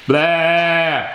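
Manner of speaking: drawn-out sleepy grumble; grumpy Toad voice. A narrator's reading. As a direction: groan it lightly as a reluctant sleeper. Sleepy Toad answers from inside.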